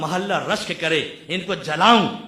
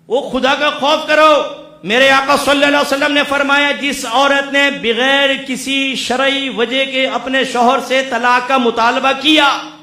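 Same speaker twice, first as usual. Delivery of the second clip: fiery preaching; 7 semitones higher, 9 dB louder than usual. Acted. Preacher scolds husbands for showing off wives, furious shout. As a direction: thunder at the husbands, building to a shout.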